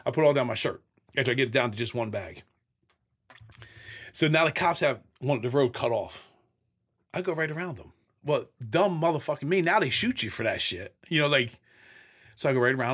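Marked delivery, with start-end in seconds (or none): none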